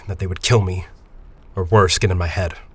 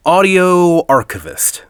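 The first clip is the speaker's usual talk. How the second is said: announced slowly, much higher and louder, like a title; grand announcement; 9 semitones higher, 6 dB louder, much slower than usual. Acted. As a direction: present the job title with ceremony. Grand offer of a new title.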